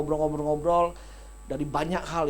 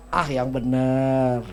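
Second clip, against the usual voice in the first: teasing disbelief; 5 semitones lower, 5 dB louder, much slower than usual. Shown as a demonstration. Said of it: "teasing doubt to congregation".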